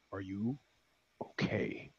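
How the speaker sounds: barely voiced whisper of the question; menacing whisper. Driver gives the last warning in a whisper. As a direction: breathe it as a final quiet threat. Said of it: "the third and last time".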